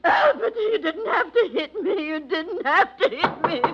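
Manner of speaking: high, slow, sobbing complaint; whimpering hurt. Acted. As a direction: whimper it through tears. Wife after being hit.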